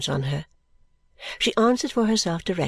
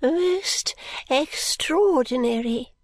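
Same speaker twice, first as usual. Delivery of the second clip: put-on high, slow, delighted old lady; bright, smiling; 8 semitones higher, much slower than usual. Miss Flite delights in her fortune, smiling and high. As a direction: beam as you say it. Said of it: "smiling brightly".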